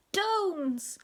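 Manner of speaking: put-on high toddler holler; piercingly high baby-talk shout; very loud baby. A narrator's reading. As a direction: call it out high and long. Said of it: "like that".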